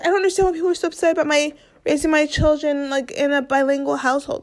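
high-pitched mock innocence; imitating a put-upon celebrity mother; defensive wife. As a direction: rush it out high, playing baffled. Voices the wife's defensive reply.

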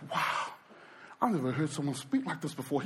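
low, softened wonder; lightly acted awestruck murmur; hushed awe. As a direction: voice a kid's quiet amazement. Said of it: "this kid's heart, it's just like".

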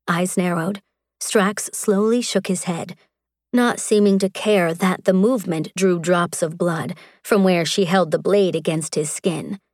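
ordinary delivery

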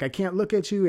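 high, loud replayed wail; helpless protest. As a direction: act out a desperate, overwhelmed reply. His helpless reply to her demand.

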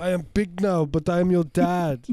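put-on Rastafarian accent, very high and loud; rasta speech. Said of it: "a Rastafarian accent the whole time".

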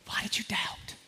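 a breathy whisper, close and intimate. Acted. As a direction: lean in and breathe the question. Jesus speaking softly to Peter.

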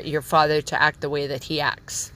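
usual speaking voice

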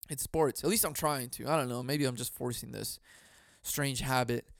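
usual speaking voice

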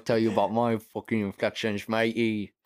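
laughing cockney mock-up; hammed-up London accent; British accent. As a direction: play a rough British bloke, half laughing. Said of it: "voice inflections of the U K".

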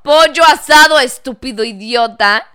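loud mock yell at the customer; mock shout. Waitress yelling the right word at a gringo, loud mock yell. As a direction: bark the correction loud.

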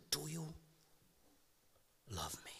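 a near-silent whisper of Jesus asking Peter; whispered question. Acted. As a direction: whisper it like an intimate plea. Jesus asks Peter, hushed.

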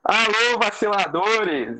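high, stretched-out podcast hello; shouty host greeting. Real, not acted. Host greeting listeners on the mic, shouty opener. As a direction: holler the show's opening. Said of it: "Para de gritar".

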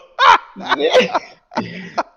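loud, high cackling laugh; real burst of laughter. Real, not acted. Recalling a funny bar memory. (carcajada fuerte y aguda)

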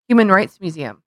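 ordinary talk

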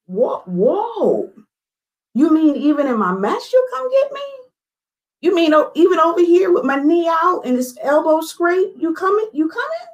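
high, awestruck questions; amazed disbelief. Retold or imitated. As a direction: marvel that God would come for you. Reliving her amazed response to God.

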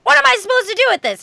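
put-on a bewildered child turning to an adult; puzzled kid. Girl asking the narrator about the fish, fast and lost. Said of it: "she looks at me and she's like".

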